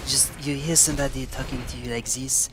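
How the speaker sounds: thick vaguely French European accent all through; ambiguous European accent. Shown as a demonstration. Streamer quizzing chat on where his fake European accent is from. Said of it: "that's basically the voice I do".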